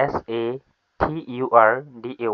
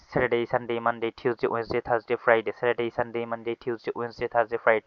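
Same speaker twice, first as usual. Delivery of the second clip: rapid, even run through the days in one breath; no-breath recitation; 5 dB quieter, faster than usual. Shown as a demonstration. Teacher shows how to read it twice without breathing, no-breath recitation. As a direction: rattle the list off without pausing to breathe. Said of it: "no breath".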